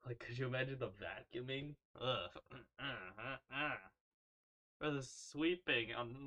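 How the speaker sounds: normal talking voice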